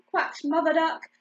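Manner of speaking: ordinary delivery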